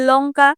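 লঙ্কা with the ল touching just behind the teeth on the gum ridge; isolated demo of লঙ্কা with alveolar ল; model word. Shown as a demonstration. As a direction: say লঙ্কা clearly to show an alveolar ল. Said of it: "প্রথমে যখন লঙ্কা উচ্চারণ করছি".

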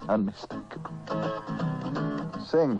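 ordinary talk

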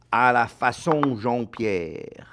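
slow, drawn-out French pronunciation. Shown as a demonstration. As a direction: savour the French accent on each word. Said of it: "That would be very French".

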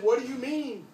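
high-pitched, indignant comeback; indignant retort. Retold or imitated. Retort to the accusing voice.

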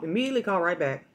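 ordinary talk

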